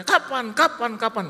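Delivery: loud, high rapid-fire mimicked demand; insistent demand. Collector demands a payment date. (desakan penagih, nyaring bertubi-tubi)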